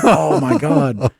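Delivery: shocked. Retold or imitated. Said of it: "I'm like".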